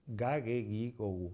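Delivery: "ga, ge, gi, go, gu" all with hard g; Latin G sounds. Shown as a demonstration. Teacher recalling the rule, hard g in "ga, ge, gi, go, gu". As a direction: recite "ga, ge, gi, go, gu" with no j sound. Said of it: "sino que es ga, ge".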